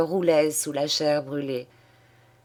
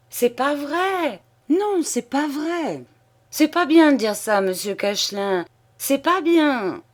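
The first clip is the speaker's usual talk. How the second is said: put-on flustered old man; 9 semitones higher, 3 dB louder than usual. Old clerk denying the teasing, high flustered protest. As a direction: squeak out the denial in dismay. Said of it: "effaré".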